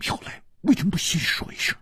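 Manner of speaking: quick, hushed pouting reproach; foot-stamping pout. A narrator's reading. Shi Xiuyun chides her late visitor, pouting. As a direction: scold him softly and fast. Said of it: "跺着脚说".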